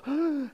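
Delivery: sharp high gasp of surprise; surprised gasp. Retold or imitated. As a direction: imitate their shocked intake of breath. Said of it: "And they go".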